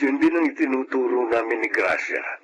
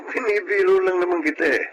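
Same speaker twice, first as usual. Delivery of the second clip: laughing; 8 semitones higher than usual. Acted. Teasing his wife after joking.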